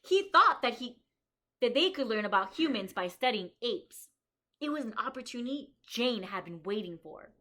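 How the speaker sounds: bright, lively storybook reading for a class; reading to children. Acted. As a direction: read it out to the kids like a story. Reads picture book aloud.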